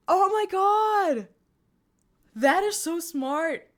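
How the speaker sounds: high, loud, drawn-out exclamation; amazed delight. Real, not acted. Streamer hears a viewer caught her hidden Easter egg, amazed delight. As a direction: stretch the words out in delighted astonishment.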